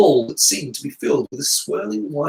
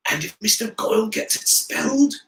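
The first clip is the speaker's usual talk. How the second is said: hiss; 6 semitones higher than usual. A narrator's reading. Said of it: "hissed Ha-, Harry".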